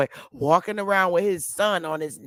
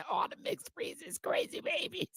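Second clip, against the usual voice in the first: soft whisper, almost no voice; conspiratorial whisper; 10 semitones higher, 14 dB quieter than usual. Real, not acted. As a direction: whisper it like a secret. Whispered aside after laughing.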